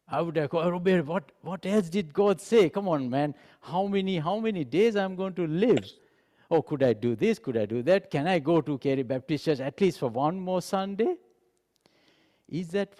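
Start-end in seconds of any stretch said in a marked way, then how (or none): 0.7-11.2 high-pitched, pressing string of questions; mock desperate bargaining for time; anxious pleading. Sick man begging a prophet for more days, anxious pleading.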